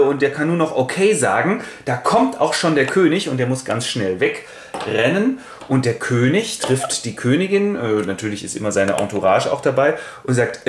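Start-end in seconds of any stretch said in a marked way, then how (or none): none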